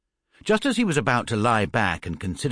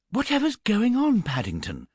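high, startled cry; surprised exclaim; 12 semitones higher than usual. A narrator's reading. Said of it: "exclaimed Missus Brown".

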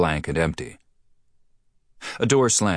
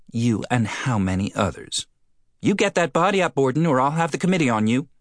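shrill threat; 8 semitones higher than usual. Acted. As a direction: threaten him like an outraged lady. Postmistress threatens marshal.

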